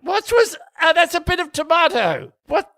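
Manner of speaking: high-pitched affronted gentleman; posh indignant surprise. Pretend. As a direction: react in outraged disbelief, pitched high. Food falls on Newton.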